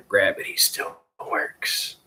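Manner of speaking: slow, voiceless goofy delivery; mock-mysterious, breath only; goofball. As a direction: drag it out in a silly stage whisper. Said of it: "why I'm being a goofball".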